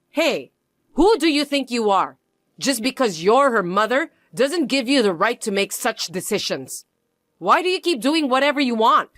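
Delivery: indignant outburst. Acted. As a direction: snap back at the mother who refuses. In-law angry at refusal.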